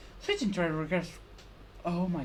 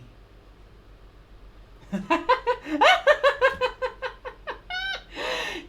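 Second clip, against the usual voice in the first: loud, high, unhinged cackle that will not stop; manic laugh; 9 dB louder than usual. Acted. A cornered fighter bursts into a crazed laugh. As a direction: laugh like an idiot in place of a yell. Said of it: "I start to laugh like an idiot".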